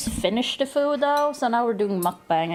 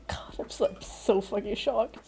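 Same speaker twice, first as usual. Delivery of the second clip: breathless whispered disbelief, laugh at the start; shocked whisper; 5 dB quieter than usual. Real, not acted. Streamer whispering to herself after a big donation, stunned. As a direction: barely voice it, still reeling.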